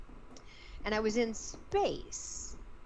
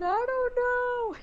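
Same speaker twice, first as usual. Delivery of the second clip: high, loud, slow helpless wail; 8 dB louder, slower than usual. Retold or imitated. Answering the hypnotist under hypnosis, helpless wail. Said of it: "And I said".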